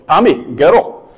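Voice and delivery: slow, drawn-out Scottish lilt; father fondly calling in a thick Scottish accent. Retold or imitated. Imitating a Scottish father-in-law addressing his daughter, Scottish accent. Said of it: "the only Scottish accent I can do".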